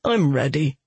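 ordinary delivery, nothing marked

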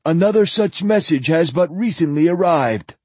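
lightly hammed-up high zealot; old zealot voice.

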